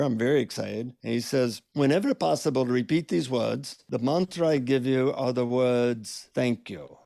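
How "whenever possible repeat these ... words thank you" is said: voiced confiding in an imitated Indian accent, slowing down; Indian-accented guru. Guru confides the secret mantra in the seeker's ear, Indian accent. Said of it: "he leans in to whisper in my ear".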